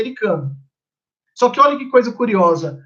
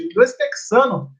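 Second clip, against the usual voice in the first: ordinary talk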